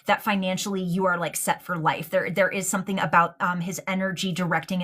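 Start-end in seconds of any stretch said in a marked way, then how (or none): none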